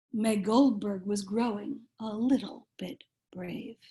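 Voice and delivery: lively picture-book read-aloud, rhyming bounce; reading to children. A narrator's reading. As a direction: read it like bedtime story time for little kids.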